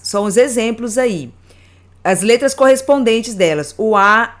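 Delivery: usual speaking voice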